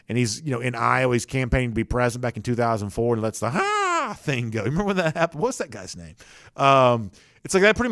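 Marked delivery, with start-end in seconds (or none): 3.5-4.1 high-pitched drawn-out mock yell; mock campaign scream. Imitates a candidate's infamous campaign scream.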